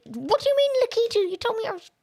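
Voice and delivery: very high, incredulous protest; indignant disbelief. Real, not acted. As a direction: squeak out an outraged question. Protesting at the game's referee.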